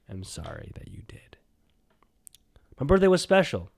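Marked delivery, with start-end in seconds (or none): 0.1-1.4 "am sorry that you did" whispered, trailing off; whispered apology. Acted. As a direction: breathe the apology out as a joke.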